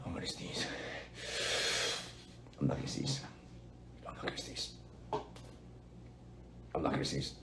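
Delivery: fading from soft to a whisper; holding a sneeze. Real, not acted. Streamer fighting a sneeze, sinking to a whisper. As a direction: let it fade to a breathless whisper. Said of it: "I have to sneeze".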